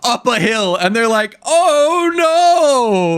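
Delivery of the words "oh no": high, hammed-up cry of dismay; fake alarm. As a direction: ham up a helpless mock protest, high. Mocking villagers' feigned alarm in film.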